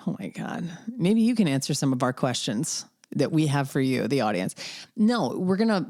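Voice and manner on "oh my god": laughing. Real, not acted. Laughs at her own slip.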